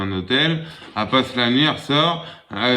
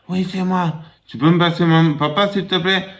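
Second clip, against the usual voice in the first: put-on child voice; 6 semitones higher than usual. Child on phone in joke.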